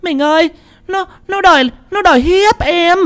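high, wailing wife's accusation; crying out. Acted. Third wife accusing the healer to her husband in a high wail. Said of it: "bà ba mới chi hô".

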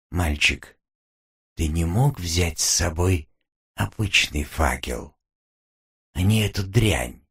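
low, hushed demon woman; a mocking demon, low and soft. Demon woman mocks the captain. (низкий голос насмешливого демона)

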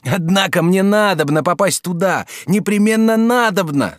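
a mild shout of resolve; shouting insistence. A narrator's reading. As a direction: refuse to be talked out of it. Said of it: "закричал Жак".

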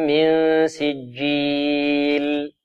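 من سجيل with nasal ikhfaa and a stopped jeem; slow recitation. Shown as a demonstration. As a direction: draw out من سجيل in chant. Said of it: "الجيم ماذا؟ شديدة".

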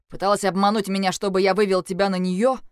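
loud, indignant questioning; angry accusation. A narrator's reading. Разозлённый Леджер обвиняет героиню. (громкое гневное обвинение)

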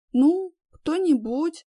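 медленное небрежное «ну, кто-нибудь»; отмахиваясь, нехотя; равнодушно. A narrator's reading. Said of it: "пожала плечами". (равнодушно пожимая плечами)

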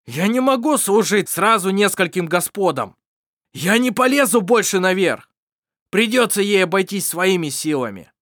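loud, bitter outburst; bitter shout. Acted. Mar shouting bitterly at his friends, loud. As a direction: spit the words out bitterly. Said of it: "с горечью прокричал, шипя".

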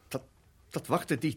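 normal talking voice

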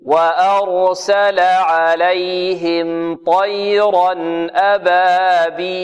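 slow, melodic tajweed chant; Quran recitation. Shown as a demonstration. Teacher leads pupils through the verse, slow Quran recitation. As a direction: recite with long held vowels, very slowly.

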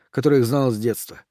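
ordinary delivery

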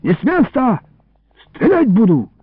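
high-pitched warning shout; yelling threat. A narrator's reading. Illarion holding thieves at gunpoint, yelling threat. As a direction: call out a sharp, high threat. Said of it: "заорал вдруг Илларион".

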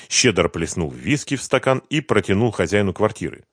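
ordinary delivery

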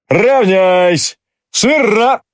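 sharp barked drill commands, high; stiff barking toy soldier. A narrator's reading. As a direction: bark the orders at the ranks. Sergeant major barks commands.

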